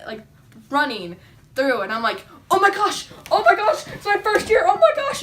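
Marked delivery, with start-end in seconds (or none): 2.5-5.2 much higher, louder, thrilled squeal; excited squeal. Retold or imitated. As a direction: gush like you just spotted your first elk. Seeing elk, first hunt excitement.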